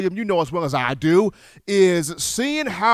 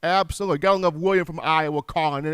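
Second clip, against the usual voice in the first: normal talking voice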